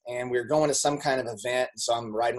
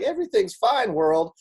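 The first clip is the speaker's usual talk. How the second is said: higher put-on sunny voice announcing all is fine; fake cheerful; 8 semitones higher than usual. Family hiding problems from the world, falsely cheerful and higher. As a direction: chirp it to the whole world with a forced smile. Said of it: "it's like".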